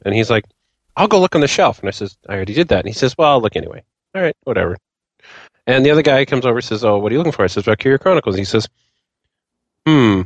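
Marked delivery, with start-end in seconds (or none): none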